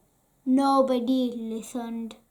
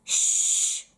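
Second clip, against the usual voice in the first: shushing. Acted. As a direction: hush the child. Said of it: "they'd say".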